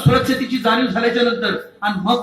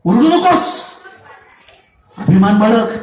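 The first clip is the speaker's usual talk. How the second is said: husband silencing wife; 4 dB louder, slower than usual. Acted. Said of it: "एकाच अकांत आक्रोश मांडला".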